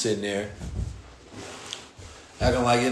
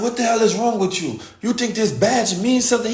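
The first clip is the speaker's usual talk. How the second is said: imitating a furious yell, higher and louder; yelling owner; 10 semitones higher, 4 dB louder than usual. Owner yells at the police in the cafeteria, furious. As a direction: play the owner scolding the cops, raised and loud. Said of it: "he's yelling at them".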